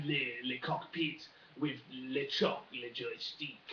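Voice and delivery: lightly put-on French accent on "le". French pilot in le cockpit, light mock French accent. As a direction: drop in a slight French "le" before each word, French accent. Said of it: "I wanna do the French accent a bit more".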